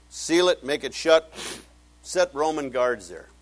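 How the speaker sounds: loud, forceful command, slowing at the end; commanding order. Retold or imitated. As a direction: bark it like an officer giving orders. Ordering the tomb sealed shut.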